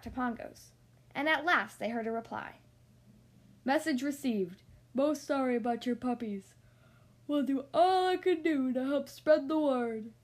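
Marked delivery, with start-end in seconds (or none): 3.6-10.1 a Great Dane howling back across London. A narrator's reading. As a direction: lightly howl the message like a Great Dane. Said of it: "howled a Great Dane".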